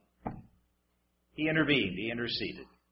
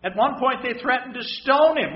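ordinary talk, nothing marked

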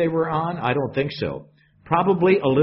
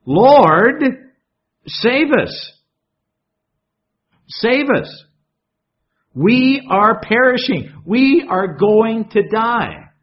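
high, loud panicked cry for rescue; panicked plea; 7 semitones higher, 7 dB louder, slower than usual. Acted. Disciples crying to Jesus in the storm, in loud panic. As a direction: cry out in terror, slowing on the last words. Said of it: "what do they have to say?".

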